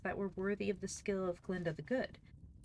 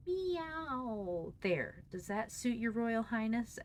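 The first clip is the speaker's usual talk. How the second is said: high mocking meow, then sneering question; scornful; 6 semitones higher, much slower than usual. A narrator's reading. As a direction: sneer at the woman like a haughty cat. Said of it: "in scornful accents".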